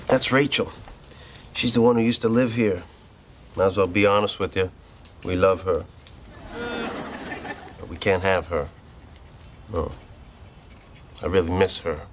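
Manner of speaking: low, heavy-hearted confiding; wistful confession. Acted. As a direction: confess a hopeless love, pitched low.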